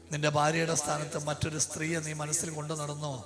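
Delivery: soft, low prayer-leading voice; quiet guided prayer. Acted. Preacher softly leading couples through confession, hushed. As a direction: guide them gently, hushed and solemn.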